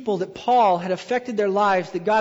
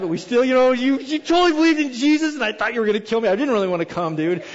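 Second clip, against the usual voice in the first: put-on loud, high, gushing to an old friend; acted giddy reminiscing, voice raised; excited reunion; 3 semitones higher, 5 dB louder, faster than usual. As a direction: blurt it out, overjoyed and amazed. Imagined excited reunion in heaven.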